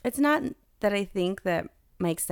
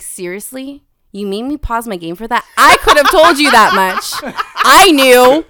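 mock gruff dad, louder and laughing by the end; laughing dad impression; 4 semitones higher, 14 dB louder than usual. Daughter voicing her dad shrugging off her coming out, laughing loudly. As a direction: play the unimpressed father who already knew.

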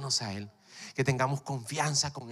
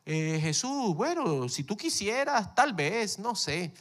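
hesitant doubting; 6 semitones higher, slower than usual. Pretend. Doubtful blind men imitated.

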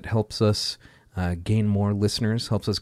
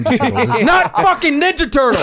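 laughing shout; 5 dB louder, faster than usual. Real, not acted. Mock-rant outburst, laughing.

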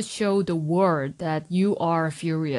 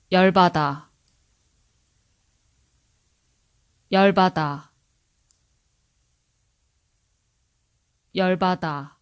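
faster; 3 dB louder, much faster than usual. Shown as a demonstration. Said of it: "Now faster".

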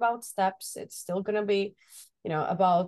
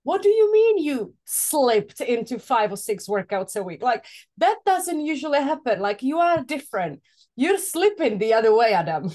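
higher, louder mock disbelief; playfully incredulous, voice pushed up and louder; imitating puzzled viewers; 5 semitones higher, 6 dB louder than usual. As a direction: challenge him as a baffled viewer would. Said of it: "they may think".

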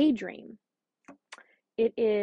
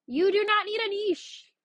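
high, pressing hard on the listener; passionately insisting, voice pitched way up; emphatic near-yell; 10 semitones higher than usual. Real, not acted. Podcast host to listener, a passionate near-yell. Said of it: "Am I yelling at you?".

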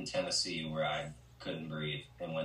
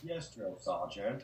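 slow, soft Forrest reply to the sergeant; yes sir military replies; 7 dB quieter, slower than usual. Retold or imitated. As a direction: answer like an eager recruit. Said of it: "done a Gump impression".

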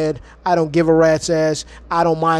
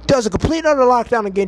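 usual speaking voice

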